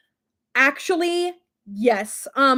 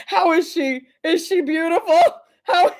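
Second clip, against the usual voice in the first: laughing; 4 semitones higher, 6 dB louder than usual. Real, not acted. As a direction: coo at your own bicep through laughter. Admiring her flexed arm, laughing.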